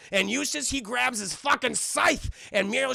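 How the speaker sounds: ordinary talk, nothing marked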